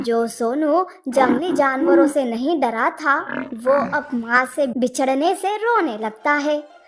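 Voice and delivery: ordinary delivery, nothing marked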